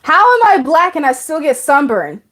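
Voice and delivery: ordinary talk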